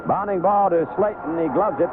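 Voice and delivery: usual speaking voice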